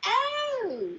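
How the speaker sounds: high-pitched, halting bad acting; terrible actor. Bad actor auditioning for the director, stilted and high. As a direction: imitate a hopeless auditioner, word by word. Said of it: "his acting was so bad".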